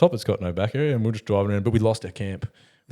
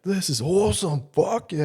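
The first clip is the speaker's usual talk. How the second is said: a higher, slow, stoked drawl of delight; stoked cheer; 8 semitones higher, slower than usual. Retold or imitated. Mate on the beach at night, high and slow stoked cheer. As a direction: soak up the beach buzz, going up high. Tripping mates hit the beach.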